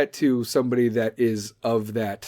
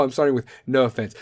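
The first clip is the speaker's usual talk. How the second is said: usual speaking voice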